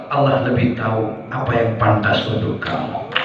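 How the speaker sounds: soft sermon counsel. Acted. Preacher drops to soft counsel.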